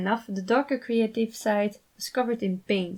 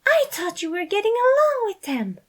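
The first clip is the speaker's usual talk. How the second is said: high, louder dismayed pleading; appalled Patton; 13 semitones higher, 5 dB louder than usual. A narrator's reading. Patton dismayed at Remus, high and louder. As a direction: protest in disbelief.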